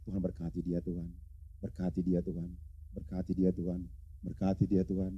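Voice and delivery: quiet repeated blessing, almost murmured; a hushed prayer so as not to wake her; soft murmured blessing. Shown as a demonstration. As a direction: softly pray over a sleeping wife. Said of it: "Jangan ucapkan kata-kata yang lain, hanya berkat".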